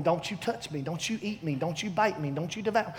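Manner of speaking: quiet, fearful little pleading; timid pleading. Retold or imitated. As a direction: softly beg the devil to stay away. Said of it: "we sit here going".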